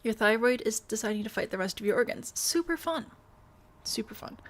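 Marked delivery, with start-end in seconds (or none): 2.4-3.0 high sarcastic bright-side quip; mock cheer, pitched high. Reacting to her thyroid, high sarcastic mock cheer. 3.8-4.4 flat, quieter deadpan echo. Acted. Echoing her own joke, quiet flat deadpan.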